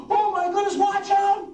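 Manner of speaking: staged excitement. Acted. Magic reveal to kids.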